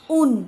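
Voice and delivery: "ऊन" with a long oo as in moon; "ऊन", long u clearly held. Shown as a demonstration. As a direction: draw out the vowel of "ऊन" before the n. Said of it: "असा दीर्घ उच्चार होतो".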